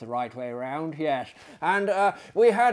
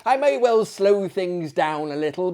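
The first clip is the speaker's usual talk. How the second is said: ordinary delivery, nothing marked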